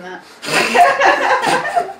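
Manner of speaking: laughing. Real, not acted. Laughing breakout.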